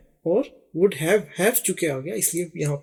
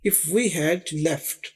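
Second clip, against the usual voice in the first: slow example read; much slower than usual. Shown as a demonstration.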